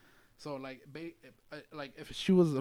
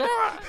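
high, laughing mock shriek of a falling kid; mock kid scream; 8 dB louder than usual. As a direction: yelp like a kid suddenly flipped over. Imagined kid flipping upside down.

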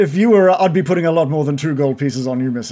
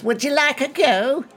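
stall woman; 10 semitones higher than usual. Acted. GM voices stall woman offering game.

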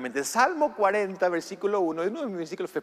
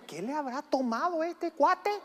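a hint of a baffled driver, higher; mock the stunned neighbor; puzzled onlooker; 8 semitones higher than usual. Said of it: "los que están a tu lado". (imitando al conductor extrañado)

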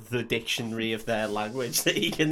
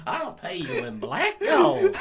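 mock southern oilman; 13 semitones higher, slower than usual.

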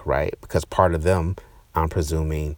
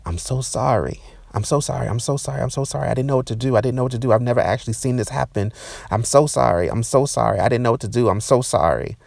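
high-pitched, frantic repeated apologizing; frantic apology; 7 semitones higher, 3 dB louder, faster than usual. Retold or imitated. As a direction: plead sorry over and over, high and flustered. Retelling the woman's panicked apology.

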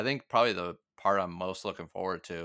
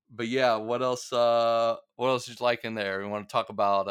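ordinary talk

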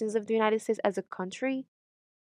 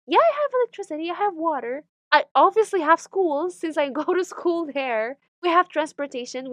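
high, louder, indignant listing; sarcastic comeback, pitched up; exasperated retort; 7 semitones higher, 5 dB louder than usual. Acted. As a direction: insist on it as if it were obvious. Exasperated answer to ignorant questions.

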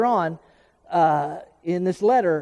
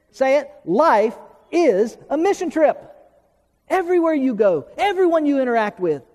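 higher-pitched, fervent preaching; worked up; 5 semitones higher than usual. Real, not acted. Preacher telling the church they are missionaries, worked up. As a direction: get carried away driving the point home. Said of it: "getting a little worked up here".

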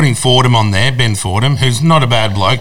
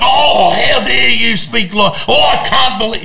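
slow, much higher, hammed-up scandalised gasp; mock feigned outrage; 12 semitones higher, slower than usual. Mocking the host's fake on-air outrage, high slow hammed-up indignation. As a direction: parody a pearl-clutching host pretending to be shocked. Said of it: "it was all feigned outrage".

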